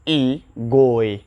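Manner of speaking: ई गोए read slowly and low as British spelling. Shown as a demonstration. Teacher mimicking how the British write it. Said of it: "वो लिखता है जी ओ".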